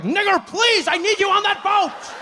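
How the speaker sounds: very high, shouting plea; high mock-desperate master shouting his plea. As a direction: beg him desperately, voice way up.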